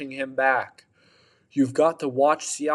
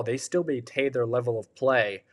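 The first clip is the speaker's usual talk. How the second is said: usual speaking voice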